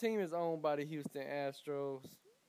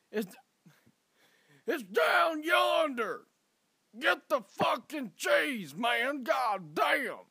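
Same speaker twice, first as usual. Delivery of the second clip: angry local; 9 semitones higher, 7 dB louder, slower than usual. Retold or imitated. Acted angry local yelling about cheese.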